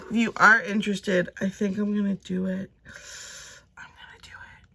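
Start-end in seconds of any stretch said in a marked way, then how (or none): 3.8-4.7 very soft, voiceless whisper; hushed ASMR-style whispering. Shown as a demonstration. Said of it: "do it like A S M R".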